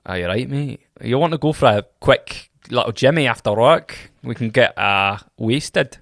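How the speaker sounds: casual Scottish invitation to the pub; matey Scottish impression; Scottish accent. Shown as a demonstration.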